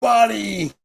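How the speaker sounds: high, loud, excited mock commentator; Kino Casino host impression. Imitating the Kino Casino host reacting to DSP news in a high hyped voice. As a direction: shout it like an amazed hype host. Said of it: "And nobody steals Joe Boo's rum".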